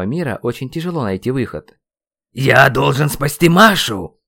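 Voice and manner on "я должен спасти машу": loud, slow, higher desperate shout; yelling. A narrator's reading. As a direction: yell it with urgency. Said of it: "продолжал я орать". (отчаянный крик)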